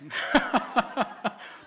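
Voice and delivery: loud, high burst of laughter; high, loud cackling laugh; hearty laugh. Real, not acted. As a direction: burst out laughing at your joke. Joke lands with the congregation.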